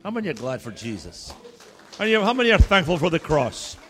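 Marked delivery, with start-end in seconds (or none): 2.0-3.8 loud rousing preacher call; rally the congregation; preaching at full force. Acted.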